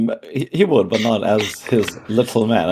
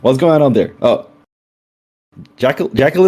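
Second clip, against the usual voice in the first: calling down from window; 6 semitones higher, 4 dB louder than usual. Acted. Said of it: "he goes".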